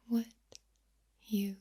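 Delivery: ordinary delivery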